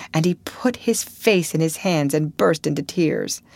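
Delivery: plain reading voice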